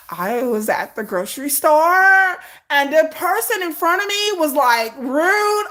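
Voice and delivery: high slow whiny put-on voice; mock whiny vlogger. Mocking a Gen Z vlogger, slow high whine. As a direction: drag out the complaint.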